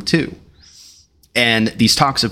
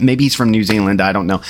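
ordinary talk

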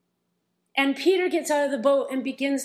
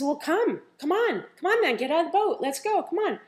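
eager urging; 6 semitones higher than usual. Retold or imitated. Jesus urging Peter out.

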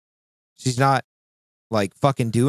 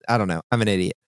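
ordinary talk, nothing marked

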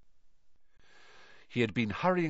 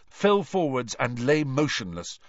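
ordinary delivery

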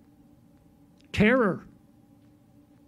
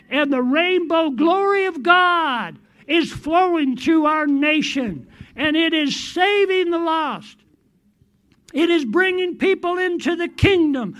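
loud, high, fervent proclamation; full-force preaching; 5 semitones higher, 3 dB louder than usual. Acted. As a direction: shout the decree to heaven. Rising declaration, preaching at full force.

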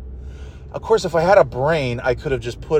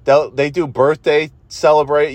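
ordinary talk

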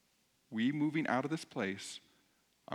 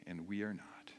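soft, low-key prayer with long pauses; 4 semitones lower, 7 dB quieter than usual. Real, not acted. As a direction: lead them gently in prayer, pausing between phrases. Leads the congregation in prayer.

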